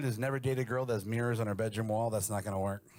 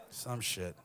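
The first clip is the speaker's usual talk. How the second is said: ordinary talk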